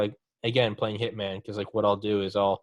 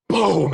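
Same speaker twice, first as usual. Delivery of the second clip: loud, higher explosion noise; boom; 7 semitones higher, 7 dB louder than usual. Retold or imitated. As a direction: blurt out a mock explosion. Mimicking a mine going off.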